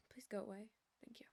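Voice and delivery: a real unvoiced whisper off-mic. Real, not acted. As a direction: hush it so the stream barely hears. Shooing someone off, quietly.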